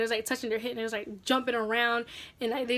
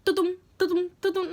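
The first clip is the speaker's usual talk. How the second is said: high-pitched thudding heartbeat noise; imitated racing heartbeat; 5 semitones higher than usual. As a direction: mimic a pounding heart, pitched up. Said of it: "my heart was racing".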